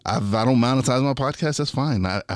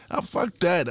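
scoffing dismissal; 9 semitones higher than usual. Retold or imitated. Dismissing doubt in inner voice.

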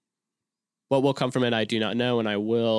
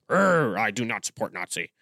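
mean tone. Shown as a demonstration. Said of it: "I said that in, like, a mean tone".

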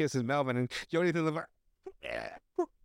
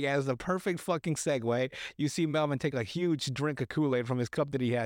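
ordinary talk

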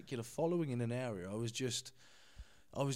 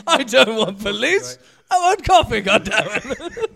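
high, loud, slow, laughing mock outrage; laughing mock-indignant quote; laughing repeat; 8 semitones higher, 16 dB louder than usual. Real, not acted. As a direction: repeat it, savouring the joke.

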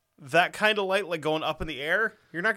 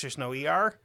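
ordinary talk, nothing marked

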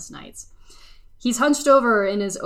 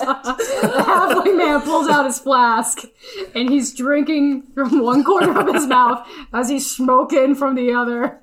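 laughing; 4 semitones higher, 6 dB louder than usual. Real, not acted. Laughing while narrating action.

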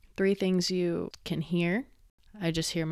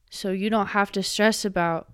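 ordinary talk

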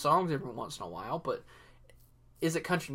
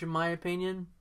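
ordinary talk

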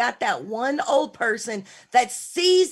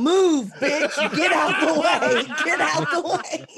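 mock rude yelling; 5 semitones higher, slower than usual. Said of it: "walking behind old people going".

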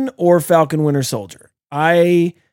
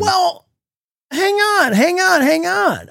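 higher, slow hang on; high-pitched hold on; 12 semitones higher, slower than usual. Real, not acted. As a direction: stretch it out, playfully high. Interrupting to hold the floor.

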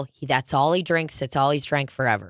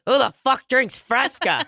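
mock incredulous; 9 semitones higher than usual. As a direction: scoff at Fresca drinkers. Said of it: "he goes".

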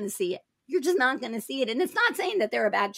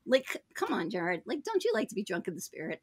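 ordinary delivery

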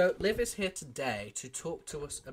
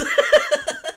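shrieking laugh; high laugh; 15 dB louder than usual. Real, not acted. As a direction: squeal with laughter. Laugh after insult.

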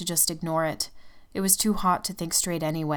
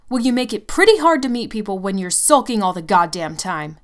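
angry accusation; 3 semitones higher, 9 dB louder than usual. Acted. She accuses Tim of sulking.

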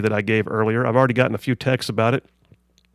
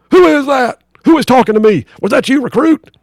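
drill sergeant; 10 semitones higher, 7 dB louder than usual. Acted. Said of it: "drill sergeant".

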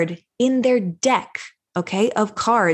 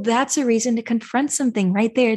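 normal talking voice

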